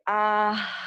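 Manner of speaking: long, open relaxing "ah"; demonstrated sighing ah. Shown as a demonstration. Yoga teacher showing the class a cleansing exhale, sighing ah. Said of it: "And then say".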